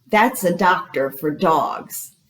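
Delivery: normal talking voice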